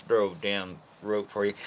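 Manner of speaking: ordinary talk, nothing marked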